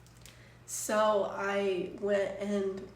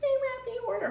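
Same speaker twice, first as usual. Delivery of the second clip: excited; 4 dB louder than usual. Real, not acted. Notices an order on stream.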